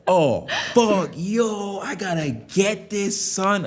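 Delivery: high, excited hype; mock hyped-up yelp; hyped gamer. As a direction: get pumped over the trailer. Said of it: "I was like".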